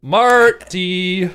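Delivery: high squeaky Harley Quinn call. Acted. Harley Quinn calling the Joker.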